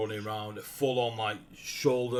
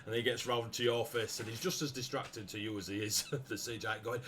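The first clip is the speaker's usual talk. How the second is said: usual speaking voice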